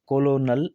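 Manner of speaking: "कोलोनल" as ko-lo-nal, three syllables; naive spelling reading. Shown as a demonstration. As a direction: misread "कोलोनल" with the L sounded. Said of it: "नहीं पढ़ेंगे". ("कोलोनल", ल के साथ ग़लत उच्चारण)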